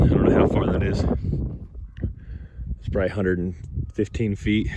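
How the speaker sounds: breathless after a steep climb; panting a little between words; out of breath. Real, not acted. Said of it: "Whew, sorry, I'm out of breath".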